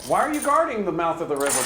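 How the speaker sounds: ordinary talk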